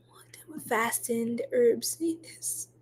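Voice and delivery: unvoiced aside; whisper. Real, not acted.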